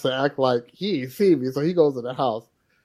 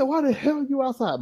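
higher, softer retold dad, mildly surprised; a hint of a puzzled father; dad's question; 13 semitones higher, 4 dB quieter than usual. Father finding his young son outside, mildly puzzled. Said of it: "He said".

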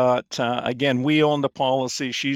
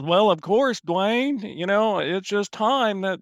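imitating an old Alabama Southerner, pitched higher; a higher, put-on Southern Alabama drawl; Southern Alabama accent; 7 semitones higher, slower than usual. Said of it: "in his wonderful Southern, you know, Alabama accent".

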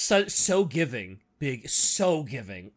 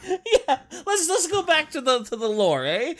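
loud, high, laughing suggestion; 14 semitones higher, 6 dB louder than usual. Real, not acted. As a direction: speak through a big laugh. Laughing along after teasing.

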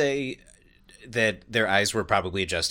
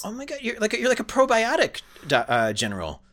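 amazed; 14 semitones higher, 3 dB louder than usual. Pretend. Improvised soldier amazed.

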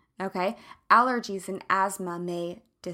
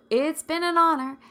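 higher, louder, showy farewell; grand sign-off; 7 semitones higher, 7 dB louder than usual. Acted. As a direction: announce "it's been an honor" big.

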